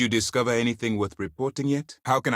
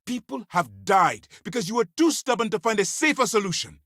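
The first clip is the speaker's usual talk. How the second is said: high-pitched, heated outburst; angry accusation; 11 semitones higher than usual. Acted. Officer blaming a stubborn agent for deaths, furious. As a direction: hurl the blame at them.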